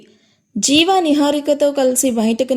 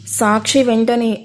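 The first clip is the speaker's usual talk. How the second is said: ordinary delivery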